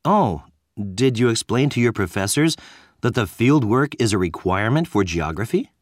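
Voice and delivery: usual speaking voice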